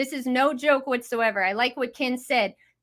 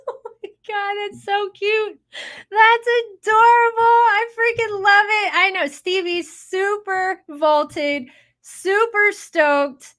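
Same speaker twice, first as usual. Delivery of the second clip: much higher and louder, squealing over something cute; gushing squeal; 8 semitones higher, 4 dB louder, slower than usual. Real, not acted. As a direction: coo with delight at the adorable clip. Gushing over friend's cute clip.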